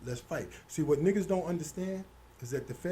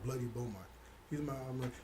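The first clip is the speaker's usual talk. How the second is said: ordinary talk, nothing marked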